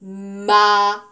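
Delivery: "Ma" with a long, loud A; drawn-out A sound. Shown as a demonstration. Teacher shows pupils the mouth shape, holding "Ma". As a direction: stretch the A of "Ma" on one note. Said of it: "vous pouvez regarder, hein, ma bouche".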